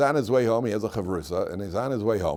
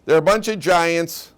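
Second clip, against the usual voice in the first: louder and higher, slowed alarm at the giants; spy returning to warn the people, loud and alarmed; alarmed report; 5 semitones higher, 8 dB louder than usual. Retold or imitated. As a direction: sound the alarm about the giants. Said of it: "the Kalev says".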